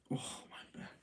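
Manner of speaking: breathy, pained whisper while stretching; strained whisper. Real, not acted. Streamer stretching sore back, whispering in pain. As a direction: groan it out under your breath as the back cracks. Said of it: "I need to pump my back".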